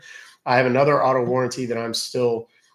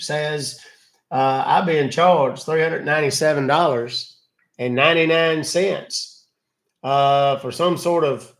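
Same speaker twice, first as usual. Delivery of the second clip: gullible caller voice; 4 semitones higher, 4 dB louder than usual. Acted. Acting victim to scammer.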